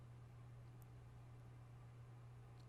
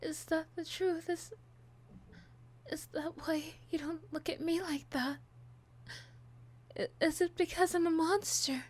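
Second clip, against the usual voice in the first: high, loud, tearful breakdown; sobbing, begging, voice breaking high; unhinged villain; 8 semitones higher, 6 dB louder than usual. Acted.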